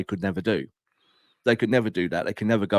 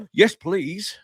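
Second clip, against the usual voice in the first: higher, hammed-up eager acceptance; mock eager yes; 8 semitones higher than usual. Glazers accepting the new CEO, higher mock delight. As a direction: grab the offer with mock delight. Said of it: "they're gonna go".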